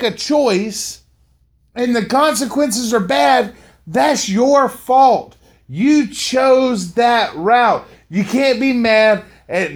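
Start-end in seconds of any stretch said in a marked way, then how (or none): 3.9-7.8 each word slowed right down; slow and deliberate. Real, not acted. Like talking to his son about bad choices, slow and firm. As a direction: spell it out slowly, like to a son.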